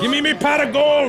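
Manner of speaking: shouted Irish leprechaun voice, high. Pretend. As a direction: yell like a greedy leprechaun. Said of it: "Crazy little leprechaun".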